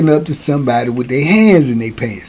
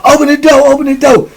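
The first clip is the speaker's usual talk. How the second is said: higher and louder, desperate; frantic plea; 11 semitones higher, 3 dB louder than usual. Retold or imitated. Burned young boy begging the guard for the door, frantic. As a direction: bang on the door with your voice. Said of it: "He said".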